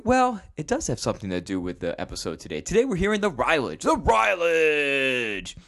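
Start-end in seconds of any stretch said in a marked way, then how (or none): 2.6-5.5 drawn-out showman call of the segment name; hyped announcement. Acted. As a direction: announce it like a game show host.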